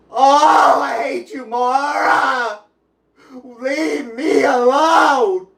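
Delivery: sobbing out at Mara, voice cracking; real tears, crying and shouting. Real, not acted. Breaking down crying while telling Mara to leave him alone.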